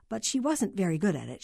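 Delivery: usual speaking voice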